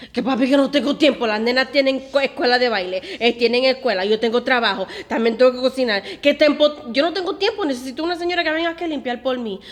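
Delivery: loud, fast, laughing complaint; exasperated protest. Retold or imitated. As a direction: rattle off every excuse, fed up. Retold argument with father.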